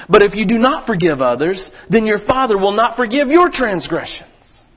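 loud, proclaiming scripture reading; pulpit scripture reading. Acted. Pastor reading Matthew six to the church, proclaiming.

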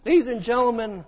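ordinary delivery

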